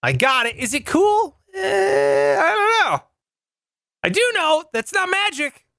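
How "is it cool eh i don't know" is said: high doubtful shrug; skeptical. Real, not acted. Doubting aside.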